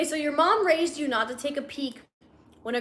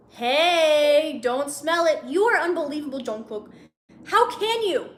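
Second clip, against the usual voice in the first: louder, higher acted telling-off; indignant scolding; 3 semitones higher, 4 dB louder than usual. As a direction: start on a loud drawn-out "Hey" and scold. Heroine scolds him in story.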